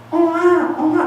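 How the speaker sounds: put-on high wailing baby voice calling Oma; mother telling how her crying baby called for grandma, in a high wail.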